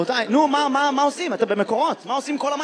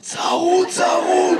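strained hoarse voice, pitched higher; 5 semitones higher, much slower than usual. Real, not acted. Audience member explaining his voice to the comedian, hoarse. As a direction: push the words out through real hoarseness. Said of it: "ממה אתה צרוד".

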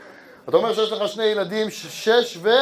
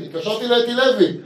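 usual speaking voice

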